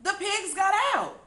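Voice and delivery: high, loud holler. A narrator's reading. Farmer hollering that the pigs escaped. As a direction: holler the alarm across the farm. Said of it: "he hollered".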